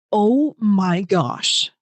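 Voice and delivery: very slow, drawn-out mock disgust; exasperated eye-roll. Lacey fed up with her crying, exasperated eye-roll. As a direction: stretch each word like a big eye-roll. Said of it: "she rolled her eyes bigger than".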